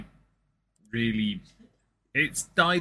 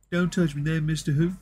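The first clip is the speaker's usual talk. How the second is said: South Park character; 5 semitones higher, 3 dB louder than usual. Pretend. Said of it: "I can definitely South Park characters".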